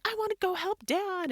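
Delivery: high-pitched mock child's whine; pleading kid. Mom imitating son who wants to help Dad, pleading. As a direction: plead like a kid afraid of missing out. Voicing her son's plea.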